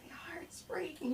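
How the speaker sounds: high, slow whispered lament; soft mock heartbreak; sobbing child. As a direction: whisper it as a melodramatic heartbroken kid. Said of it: "the mom can't hear".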